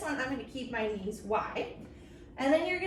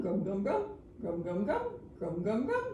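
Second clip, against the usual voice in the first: low chewing sound; 6 semitones lower, 4 dB quieter than usual. Pretend. Hippo chewing, lower.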